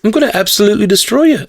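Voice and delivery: high, quick, playful Irish lilt; cheerful Irish murder talk; light Irish accent. Shown as a demonstration. Showing how harmless Irish threats sound, light Irish accent. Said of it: "very light".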